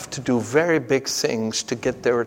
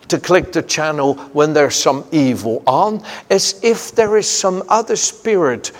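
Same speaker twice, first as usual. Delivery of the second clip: fervent preaching; 5 dB louder than usual. Acted. Preacher stirring his congregation, fervent and loud. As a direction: preach it out with rising fire.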